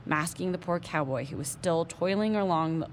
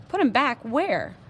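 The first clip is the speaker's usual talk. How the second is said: high, slow, loud disbelief; alarmed protest; 6 semitones higher, 5 dB louder, slower than usual. Acted. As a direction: refuse it, alarmed.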